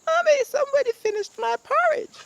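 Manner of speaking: very high, loud tiny bear voice; Baby Bear. Acted. Baby bear cries to mommy about the porridge. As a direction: squeal it like a wailing little cub. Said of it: "Baby Bear went".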